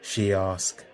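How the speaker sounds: ordinary delivery, nothing marked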